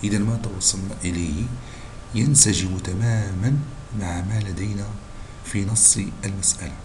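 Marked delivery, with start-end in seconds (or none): none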